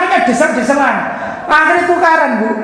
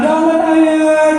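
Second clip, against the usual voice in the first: slow, hammed-up sinetron wail; mock soap opera plea; 4 semitones higher, much slower than usual. Preacher mocking how a soap opera daughter pleads, slowly hammed. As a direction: drag out "Jangan, ayah" like a soap star. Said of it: "kalau di sinetron".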